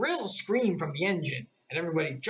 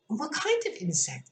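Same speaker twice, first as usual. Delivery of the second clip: mildly anxious Alice; 8 semitones higher than usual. A narrator's reading. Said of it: "inquired a little anxiously".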